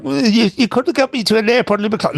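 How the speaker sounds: high, put-upon grumble; half-joking moan about the long haul; mock complaint. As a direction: whinge it like a tired traveller who was promised a short walk. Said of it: "I'm there going".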